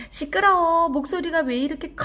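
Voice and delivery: high, loud outburst of a startled goblin; acted startled exclamation. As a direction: complain at the noise, pitch jumping way up. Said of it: "도깨비가 깜짝 놀랐어요".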